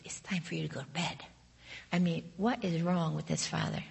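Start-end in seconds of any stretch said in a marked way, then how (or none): 0.0-1.2 whispered, hushed bedtime order; father's whisper. Retold or imitated. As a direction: whisper it like a dad settling a child. Father sends toddler to bed.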